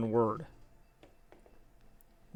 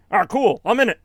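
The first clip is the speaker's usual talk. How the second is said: higher, louder cartoon mascot voice; Sonic; 6 semitones higher, 5 dB louder than usual. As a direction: chirp it as a pleased little game hero. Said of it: "It's your movie".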